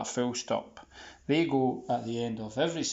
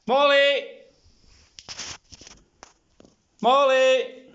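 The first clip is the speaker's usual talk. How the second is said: calling out; 12 semitones higher, 12 dB louder, slower than usual. Real, not acted. Calling the dog from afar.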